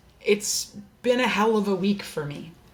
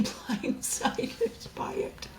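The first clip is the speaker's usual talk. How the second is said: tearful; 6 semitones higher, 7 dB quieter, much slower than usual. Real, not acted. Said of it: "Sorry, I'm getting emotional".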